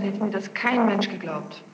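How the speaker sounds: usual speaking voice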